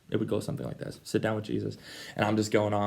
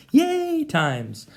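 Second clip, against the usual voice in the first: mock cheer; 5 dB louder than usual. Mocking happy times.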